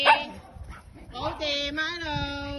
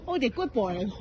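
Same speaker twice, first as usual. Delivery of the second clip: ordinary delivery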